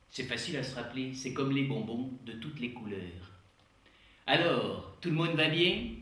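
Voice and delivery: lecturer with a Québécois twang throughout; Québécois accent, comic conference host; put-on Québécois accent. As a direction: play a Quebec showman professor. J'ai pris cet accent.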